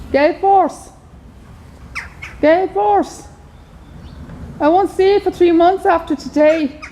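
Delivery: loud, real call to a horse. Real, not acted. Calling a horse on the last visit for three months, calling out. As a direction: call the name out to carry across distance.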